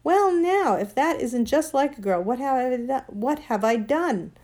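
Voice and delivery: loud, high-pitched protest; indignant exclamation. A narrator's reading. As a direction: protest as if wrongly accused. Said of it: "exclaimed Diggory".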